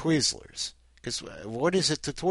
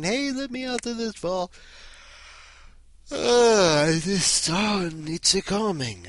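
high, strained cry from the bank vault; high-pitched panicked creature voice; trapped wolfman; 9 semitones higher, 4 dB louder, slower than usual. Acted. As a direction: squeal it out in panic as the sun nears.

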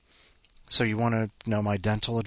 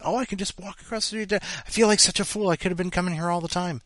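much higher, amazed self-mockery; flabbergasted; 9 semitones higher than usual. Realizing the dentist is across the street, flabbergasted. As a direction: gush it in disbelief. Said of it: "I was so flabbergasted".

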